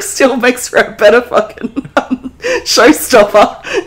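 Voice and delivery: ordinary delivery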